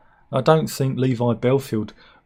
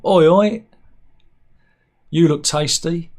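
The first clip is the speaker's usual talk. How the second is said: loud, higher hammed-up catcall; casual catcall; 5 semitones higher, 6 dB louder than usual. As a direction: call it out cheekily across the road. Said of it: "started with a casual".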